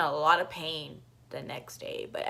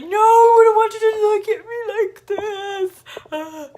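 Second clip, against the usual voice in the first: high, teary wail, slow and much louder; crying; 14 dB louder than usual. Retold or imitated. To her boyfriend after the fall, a teary sobbing plea. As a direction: beg him not to look, sobbing in retold panic.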